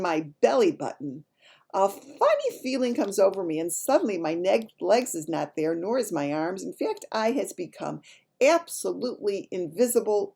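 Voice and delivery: lively, emphatic storybook reading; animated story narration. A narrator's reading. Child narrator telling a dream from a storybook, animated narration. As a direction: read it with relish for young listeners.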